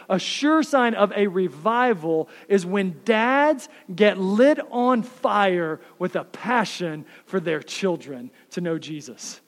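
ordinary delivery

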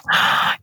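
sharp intake of breath; excited gasp. Real, not acted. Brainstorming a stage show, excited gasp. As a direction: gasp at a sudden idea.

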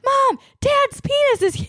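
put-on high, breathless little boy voice; frantic child voice. Son bursts into the kitchen to tell mom, high and frantic. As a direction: blurt it out like a frantic little kid.

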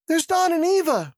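very high-pitched cry at normal volume; high, restrained yell; yelled objection. A narrator's reading. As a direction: voice a woman yelling, mostly through pitch. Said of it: "Missus Hutchinson yelled".

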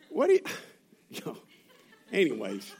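giggle, then drop it; nervous laugh. Real, not acted. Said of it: "there's nervous laughter in this place".

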